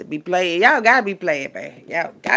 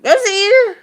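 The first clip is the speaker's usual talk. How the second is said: high, loud cry; sudden shrill exclamation; outburst; 13 semitones higher, 12 dB louder than usual. Real, not acted. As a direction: burst out with it.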